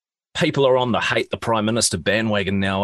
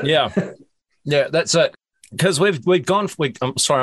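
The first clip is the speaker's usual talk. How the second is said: mouth full; 4 semitones higher than usual. Real, not acted. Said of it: "I'm eating biscuits".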